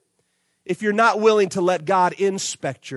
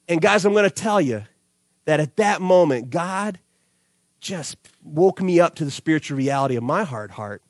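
ordinary talk

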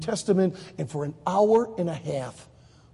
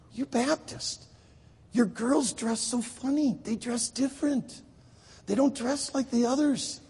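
slow, higher imitation of a baffled observer; puzzled Israeli; 4 semitones higher, slower than usual. Israeli telling Jim how odd Baptists are, puzzled.

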